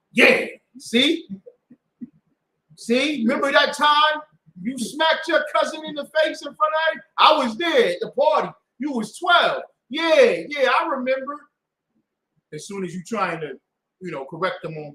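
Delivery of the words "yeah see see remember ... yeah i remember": high, loud gloating shout at a sinner; mock accusing shout. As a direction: yell it like a gossip dragging up old sins.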